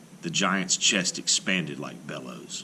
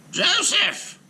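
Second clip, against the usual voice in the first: high, loud call across the lane; 5 dB louder than usual. A narrator's reading. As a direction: call to the giant standing still. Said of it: "called the rabbi".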